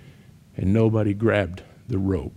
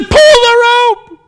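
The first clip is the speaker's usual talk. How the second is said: even louder, high, slow shout, x0.6; the rescuer screaming again at the shore as no one pulls; desperate shout; 12 dB louder, slower than usual. Retold or imitated. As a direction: scream it as a last plea.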